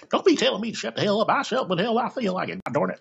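high, fast imitation of an angry Carolina mother; mock Carolina accent. Mom scolding her son who told her to calm down, mock angry Carolina accent. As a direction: rattle off a hammed-up Southern scolding, pitched well up. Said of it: "You starting to sound like a Carolinian now".

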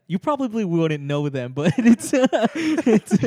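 words break up into real laughter; can't hold the quote for laughing; the quote dissolving into giggles; laughing through it. Real, not acted. Retelling Chance's sass, cracking up.

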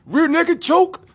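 high, drawn-out mock battle cry; hammed-up loud, slow fight yell; shouted move call. Acted fighter announcing his move.

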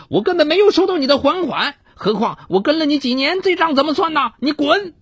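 high, fast yelling woman; shouting. A narrator's reading. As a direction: yell her denial. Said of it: "她嚷道".

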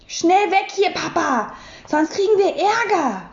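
plain reading voice

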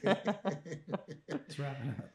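slow, stretched, laughing echo; drawn-out mimicry. Echoes her quote, laughing, drawn out.

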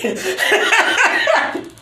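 squealing laugh; high cackling laugh. Real, not acted. After joking about screaming.

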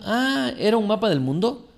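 put-on a kid who had no idea; innocent child. Child surprised in story. (niño inocente y sorprendido)

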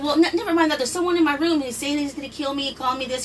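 high, urgent pleading; frantic. Retold or imitated. Scared sister telling her brother someone is in her room, high and urgent. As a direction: rush it out in alarm.

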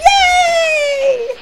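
high, loud joyful yay; cheer. Acted. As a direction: burst out cheering. Toys cheering.

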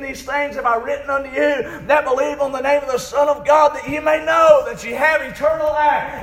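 relentless high, loud sermon cadence; full-force preaching. Real, not acted. Preacher assuring the church of eternal security, full-force preaching. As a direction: drive each phrase home, barely stopping.

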